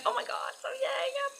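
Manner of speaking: stunned giddy squeak; giddy disbelief. Retold or imitated. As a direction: gasp it out in shock. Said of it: "And I'm like".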